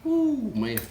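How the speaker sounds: high delighted ooh, man; excited ooh. Real, not acted. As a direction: gush over the food. Tasting food, delighted exclamation.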